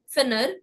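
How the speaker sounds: finir wrongly said as "finner"; English-style short vowels and "er" ending on finir; wrong vowel demo. Shown as a demonstration. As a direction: mispronounce finir as /fɪnər/. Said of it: "In no place will it be finir".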